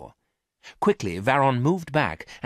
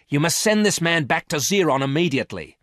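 angry demand; 8 semitones higher, 6 dB louder than usual. A narrator's reading. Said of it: "said the woman".

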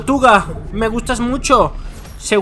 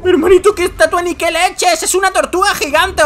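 excited calling out; 9 semitones higher than usual. Real, not acted. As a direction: point it out in sheer disbelief. Asombro ante tortuga gigante. (llamada aguda y asombrada)

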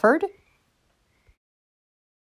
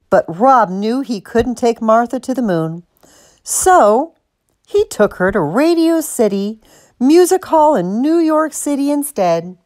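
plain reading voice

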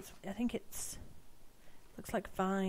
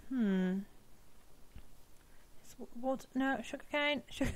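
usual speaking voice

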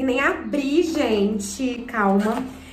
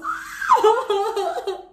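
high-pitched giddy laughter; overjoyed laugh; 9 semitones higher than usual. Real, not acted. Creator admiring the beautiful plaque, overjoyed laughter. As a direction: let out a squealing laugh.

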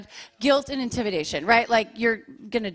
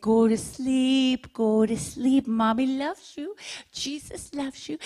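soothing lullaby voice; slower than usual. Retold or imitated. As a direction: lull them like a sweet mom at bedtime. Mother tucking kids in.

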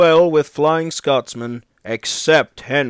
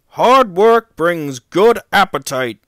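mild irritated retort, louder and higher; touchy comeback with raised voice; snappish; 6 semitones higher, 7 dB louder than usual. A narrator's reading. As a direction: snap it back at a teasing colleague. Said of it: "snapped Gordon".

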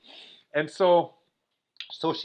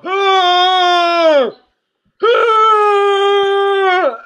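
long, high, loud moaning cry; mimicked drawn-out groan of pain, pitched high; imitated winded wail; 12 dB louder than usual. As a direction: wail like someone knocked breathless. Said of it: "she just laid on the ground going".

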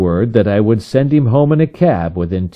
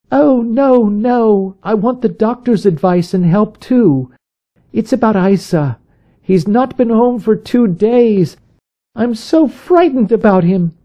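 very high, anxious woman's voice; frightened visitor pleading about her husband; frightened plea; 13 semitones higher than usual. A narrator's reading. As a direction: sound scared for her husband.

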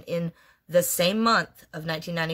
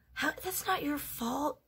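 higher, soft reassuring husband voice; husband comforting; 9 semitones higher, 7 dB quieter, slower than usual. Retold or imitated. Husband reassuring his crying wife, high and soft. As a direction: gently tell her it's not her fault, voiced higher and softer.

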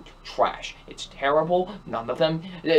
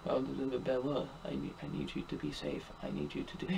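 much lower, much softer lovesick murmur, fading to a whisper; hushed mock heartthrob, lower and softer; mocking the shallow hero; 3 semitones lower, 17 dB quieter than usual. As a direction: croon it like a brooding boyfriend, barely voiced. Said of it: "he plays the same old shallow character".

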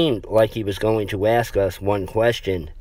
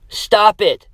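high, loud upset yell; 11 semitones higher, 6 dB louder than usual. Acted. Samantha yells at the boys to stop scaring her. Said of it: "Samantha yelled at us".

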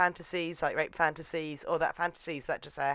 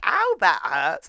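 loud, drawn-out ow; mock pained yelp; 2 semitones higher, 6 dB louder, slower than usual. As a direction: complain of pain, hammed up and slow. Acted cry of pain.

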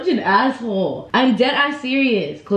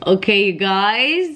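usual speaking voice

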